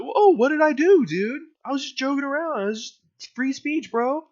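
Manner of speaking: high, loud fake-shocked whine; mock innocent surprise. Mocking the bigot acting shocked after a beating. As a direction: play it all innocent and hurt.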